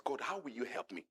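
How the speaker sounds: ordinary talk, nothing marked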